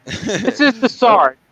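high, slow sergeant puppet voice. Pretend. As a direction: voice the little puppet, drawn out. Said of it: "I'm still talking with my animal voice".

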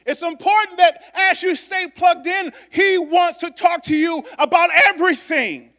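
high, loud preaching shout; shouting the point home; full-force preaching. Real, not acted. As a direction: lift the room with it. Preaching climax.

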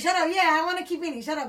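acted-out stubborn protest; mock defiance. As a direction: shut down the nagging inner voice, loudly. Acting out shutting down inner voice.

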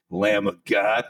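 ordinary delivery

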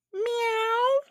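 very high cat meow. A narrator's reading. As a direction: mew like a cat.

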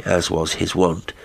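ordinary delivery, nothing marked

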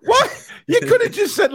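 high, laughing protest; laughing disbelief. Real, not acted.

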